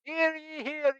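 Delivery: high, ringing hear ye call; town crier. Acted. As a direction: announce it like an old European town crier. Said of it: "start doing kinda like the town crier".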